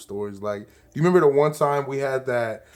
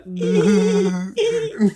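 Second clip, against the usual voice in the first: imitated giggling; 2 semitones higher than usual. Co-host mocking giggles on the first episode, imitated giggling. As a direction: mimic a giggle fit heard off to the side. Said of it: "I was super giggly".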